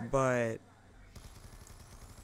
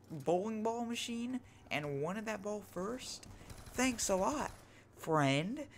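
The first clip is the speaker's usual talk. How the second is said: sarcastic; 7 semitones higher, slower than usual. Retold or imitated. Sarcasm at friend taking ball.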